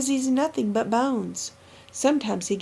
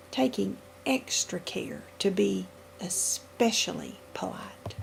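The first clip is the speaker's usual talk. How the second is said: softer, fading to a whisper; hushed; 3 semitones lower, 7 dB quieter than usual. A narrator's reading. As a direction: drop almost to a whisper on "polite". Narrator drops to a hush.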